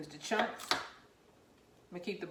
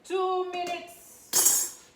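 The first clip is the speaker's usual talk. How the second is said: drawn-out call; 11 semitones higher, 6 dB louder, much slower than usual. Real, not acted. Calling out the timer.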